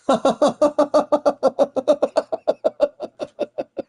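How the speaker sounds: giggling fit, high up; laughing hard at his own joke; high burst of laughter. Real, not acted. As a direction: break into a real high laugh. Laughing at own car tire joke.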